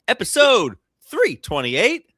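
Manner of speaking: put-on slow, hammy Italian accent; lightly imitated Italian accent. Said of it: "he said in an Italian accent".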